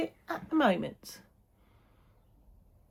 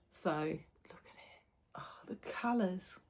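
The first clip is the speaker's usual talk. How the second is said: awed whisper; 7 dB quieter, slower than usual. Real, not acted. Admiring the stitched colours.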